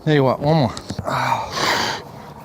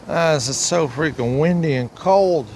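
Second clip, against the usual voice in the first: ordinary talk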